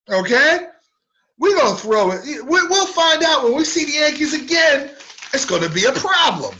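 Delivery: loud, high mock-tough threat; gangster talk. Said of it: "talking like a gangster".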